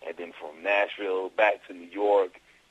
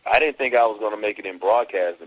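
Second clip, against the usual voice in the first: normal talking voice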